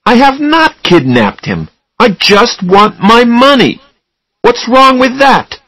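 very high, loud, indignant denial; heated protest. A narrator's reading. Charlie defending himself to Susanna, angry shouting. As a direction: protest hard, drawing out the words.

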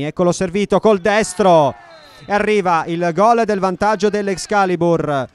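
loud, high goal call; breathless italian goal commentary. Real, not acted. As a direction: shout the goal, all excitement. Goal scored, commentator erupts.

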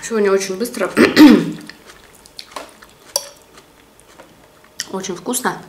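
chewing between words; eating while talking; mouth full. Real, not acted. Seen 3: Bite at -2.0, mouth working through; another bite at 5.1.

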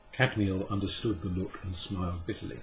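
ordinary delivery

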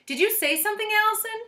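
high and loud cheery; sweet high lady voice. A narrator's reading. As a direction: sing out her name. Teacher through door.

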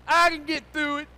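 high, loud mock bravado; defiant shout. Preacher voicing stubborn listeners, defiant shout.

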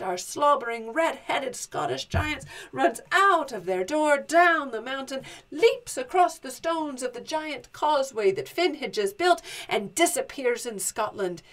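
ordinary delivery, nothing marked

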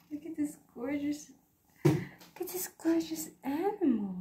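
dote on the kitten in a sing-song. Real, not acted. Cooing to her cat.

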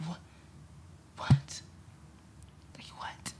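stunned whisper. Real, not acted. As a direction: breathe out the question, stunned.

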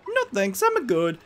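high little polite refusal; cartoon hero voice. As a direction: chirp a cheery no thanks. Mario declines the practice.

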